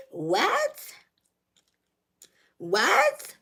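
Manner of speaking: high rising, incredulous what; disbelief. Acted. Woman questioning partner who won't deliver, incredulous. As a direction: ask it sharply upward in disbelief.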